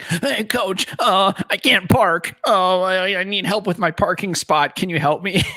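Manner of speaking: high, slow whiny little weasel; sniveling snob. Retold or imitated. Coach pretending to be a sniveling employee, high whine. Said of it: "he acts like a snob, like a sniveling little idiot".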